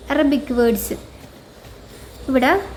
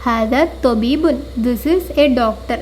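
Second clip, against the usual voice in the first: slow, careful Arabic and English answer; slow teacher reading; slow model answer; much slower than usual. Shown as a demonstration. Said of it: "മൻ ഹാദ, who is this?".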